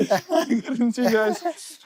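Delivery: giggling high and breathless; laughing apology. Real, not acted. Laughing after popping balloon.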